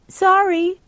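put-on high-pitched little boy apologizing; startled boy's apology. A narrator's reading. Caught boy voiced in story.

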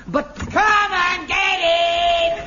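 slow, high, ringing bellow; booming call. Acted. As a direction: sing it out like a parade-ground call. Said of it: "Such resonance".

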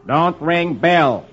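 slow, deliberate reading; sign read aloud. Acted. Reader voicing Donald's doorbell sign, slow and deliberate. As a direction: spell out the notice word by word.

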